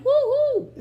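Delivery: high, loud "woo-hoo"; celebratory whoop. Real, not acted. Daughter shouting out her mama's church, celebratory whoop. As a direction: whoop it out for your own church.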